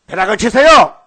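loud, high cry daring God to strike; acting out a shouted plea to heaven; desperate prayer shout. Man praying on the mountain to God, desperate shout.

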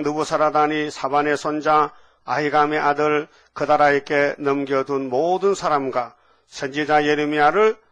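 measured, emphatic read-aloud in phrases; scripture reading. Real, not acted. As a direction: declaim the verse, pausing between names. Reads scripture aloud to congregation. (또박또박 힘주어 성경 봉독)

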